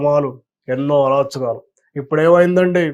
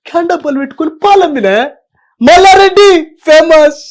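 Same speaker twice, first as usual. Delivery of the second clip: louder, higher boastful politician voice; loud higher mimicry of a boastful politician; Malla Reddy impression; 6 semitones higher, 7 dB louder than usual. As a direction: brag like a self-made tycoon on stage. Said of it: "మల్లారెడ్డిది కూడా super ఉంది, super voice".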